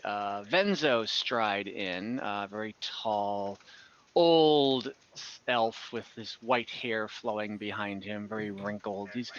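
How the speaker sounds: ordinary delivery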